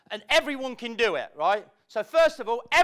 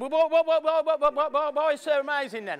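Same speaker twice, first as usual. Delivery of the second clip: higher, stuttering salesman caught off guard; mock panicked salesman; flustered stammer; 6 semitones higher, 5 dB louder than usual. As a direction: stammer like he lost his script. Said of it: "his voice went".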